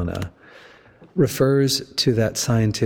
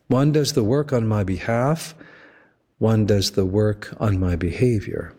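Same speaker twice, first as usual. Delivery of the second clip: ordinary talk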